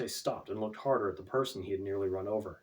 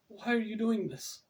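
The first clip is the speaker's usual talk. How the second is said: frightened; 12 semitones higher than usual. A narrator's reading. Frightened captive pleads.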